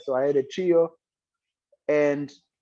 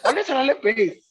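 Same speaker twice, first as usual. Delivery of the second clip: much higher imitation of the mentor calling the kid over; 11 semitones higher, 4 dB louder, faster than usual. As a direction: call him over in a high, put-on mentor voice. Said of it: "uBrafana is like".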